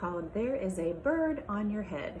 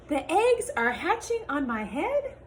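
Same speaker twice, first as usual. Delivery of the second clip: lively picture-book read-aloud with character voices; reading to children; 4 semitones higher than usual. Acted. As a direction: read it to a class of little kids.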